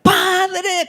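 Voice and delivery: high, sustained call to the Father; acted plea in prayer; imploring cry. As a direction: re-enact a prayerful cry, voice lifted. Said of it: "yo a veces quedo".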